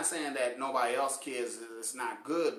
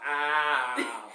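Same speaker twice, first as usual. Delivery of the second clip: loud, drawn-out mock ow; hammed-up whine of pain; mock pained cry; 3 semitones lower, 5 dB louder than usual. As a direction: imitate someone nearly crying in pain. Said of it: "She almost cried".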